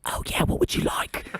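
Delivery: hushed whispered reply. Retold or imitated. Front desk clerk whispering back to a sick guest. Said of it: "Oh, he's whispering. I should whisper with him".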